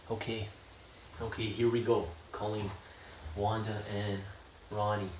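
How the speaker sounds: quiet nervous self-talk. Real, not acted. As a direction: murmur it under your breath before dialing. Nervous, psyching up before call.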